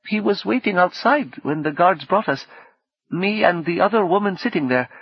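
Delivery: high-pitched, careful character voice; woman guest's voice. Acted.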